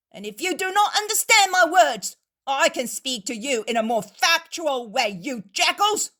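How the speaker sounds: loud, high defiant threats; angry innkeeper. Acted. Innkeeper throws the Pharisees out, loud and defiant. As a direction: roar him out of the house.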